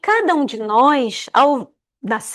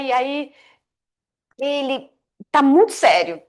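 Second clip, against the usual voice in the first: plain reading voice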